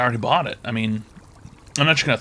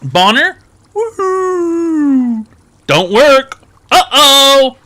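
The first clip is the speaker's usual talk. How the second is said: hyped announcer; 10 semitones higher, 7 dB louder than usual. Acted. Mock announcement to the viewers.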